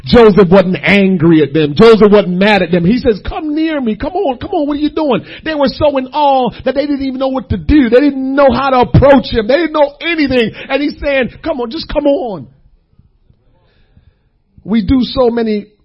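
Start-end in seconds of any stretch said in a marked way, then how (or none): 3.3-5.2 higher, eager, coaxing tone; coaxing invitation. Acted. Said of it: "He wasn't standoffish". 11.4-12.5 higher, eager, coaxing tone; coaxing invitation. Acted. Joseph urges brothers closer.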